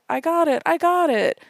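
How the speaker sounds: high, loud ecstatic cry; excited cry. Retold or imitated. Emily freaking out on the show, high and loud. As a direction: shriek it out in triumph. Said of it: "between all of these other lines".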